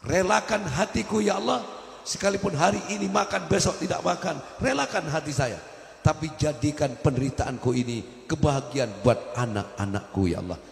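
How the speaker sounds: pleading prayer pitched high; a believer's high imploring prayer; heartfelt prayer. Acted. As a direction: cry out to God, high and earnest. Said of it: "Tapi katakan".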